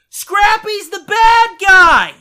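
high, loud, slow spoiler yell; gleeful shout. Retold or imitated. Neighbor yelling the spoiler, slow high and loud. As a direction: yell the spoiler slowly, to hurt. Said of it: "He goes".

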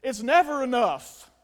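loud, slow declaration; emphatic cry. Real, not acted. Preacher hammering the point to the congregation, loud and slow. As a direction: belt it out, leaning on "never". Preacher drives point home, then repeats low.

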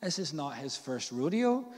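normal talking voice

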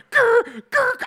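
high loud growling car noise; mock engine growl. Acting out the driver's move, engine growl. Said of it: "Vin Dieseled the fuck out".

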